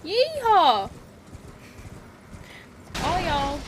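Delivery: loud, high whooped Southern yeehaw drawl; hammed-up Southern cowboy cheer; southern accent. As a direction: play up the Southern accent with a big holler. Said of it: "Every time I do a southern accent".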